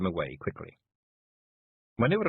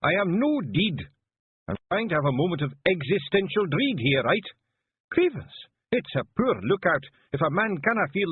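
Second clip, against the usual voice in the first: lightly voiced Feegle chief, higher and exasperated; a hint of an offended wee Scots warrior; indignant Rob Anybody; 11 semitones higher, 4 dB louder than usual. A narrator's reading. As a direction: grumble grandly about fate. Rob protesting his dread.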